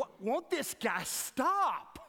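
slow, dragged-out mock groan of impatience; high, weary complaint; exasperated. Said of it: "I used to think".

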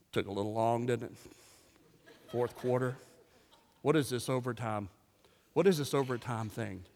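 very low, quiet, unimpressed; mock bored spectator. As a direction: mutter it like a joyless killjoy. Acted bored watcher of the game.

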